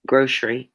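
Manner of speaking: "Grocery" in two syllables with a sh sound; "Grocery" said the Southern way, like groshery. Shown as a demonstration. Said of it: "I, I say grocery".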